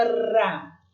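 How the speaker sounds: "Ra" with a long rolled tongue-tip R; weak R demonstration. Shown as a demonstration. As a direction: draw out the tapped R in "Ra" into a trill. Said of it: "esse som do R fraco".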